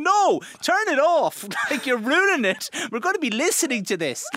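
high-pitched indignant protest, acted out; retold shouting back. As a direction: argue back over a shouting bar, hammed-up. Acted shouting match with the bar.

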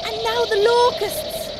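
ordinary talk, nothing marked

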